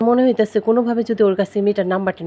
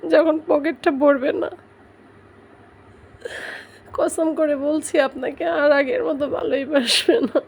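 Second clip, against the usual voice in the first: crying; 4 semitones higher than usual. Real, not acted. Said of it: "আমি কেন কান্না করতেছি".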